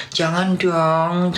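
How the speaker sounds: drawn-out high mock plea, very slow; mock pleading. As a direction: stretch it out like a whiny plea. Mocking those who go soft.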